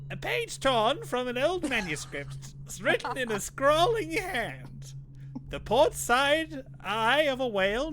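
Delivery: put-on high, slow old crone voice; Granny Rags voice. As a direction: creak it out like a hag reading a spell. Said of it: "the Granny Rags voice".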